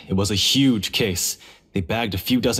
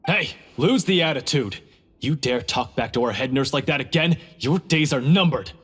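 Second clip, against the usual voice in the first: stern warning; 5 semitones higher than usual. Acted. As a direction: put the prisoner in his place. Guard scolds rude prisoner.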